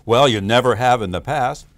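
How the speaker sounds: loud, blunt inner critic; acted loud, dismissive retort; negative inner voice. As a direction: blurt it back forcefully as the nagging voice. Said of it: "a conversation with your negative voice".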